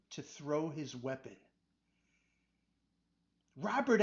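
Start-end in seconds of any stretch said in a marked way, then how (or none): 3.5-4.0 high, loud warning call. Acted. Adam warning Robert across the wagon, calling out. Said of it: "Adam called out".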